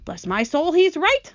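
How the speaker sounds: startled exclamation leaping high at the end; exclaiming. A narrator's reading. Old grasshopper seeing the centipede is right, exclaiming. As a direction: exclaim it in sudden realisation. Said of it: "the old green grasshopper exclaimed".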